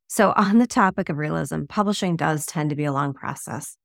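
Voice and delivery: ordinary talk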